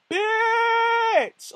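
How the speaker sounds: high, drawn-out playful shout; excited friend's yell. Retold or imitated. Fan bursting in on friend Jesse, excited yell. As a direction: stretch it out loud and high, hailing a friend.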